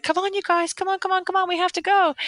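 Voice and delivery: high, rushed mock hurry-up; hurrying the kids. Mom hurrying her kids to leave in the morning, high and urgent. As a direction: chivvy the kids out the door. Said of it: "So I was like".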